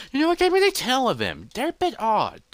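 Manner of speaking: high, quick in-character chatter; musing aside. Acted. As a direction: rattle it off high and fast. Character chats aloud, pitched far up.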